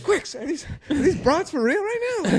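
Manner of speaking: very high mock disbelief; hammed-up high indignant question; incredulous squeal. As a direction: squeak it like an affronted friend. Repeats the friend's indignant reaction.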